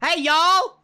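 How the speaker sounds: loud, high Southern holler; mock Southern greeting, belted out; Southern accent. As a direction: call out with a broad Southern twang. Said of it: "Flip-flopping between a Southern and a German accent".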